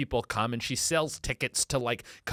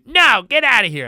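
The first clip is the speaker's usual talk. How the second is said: angry rant; 8 semitones higher, 10 dB louder than usual. Acted. Brenda scolding police who arrived late, loud angry rant. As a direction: tear into the cops for being late.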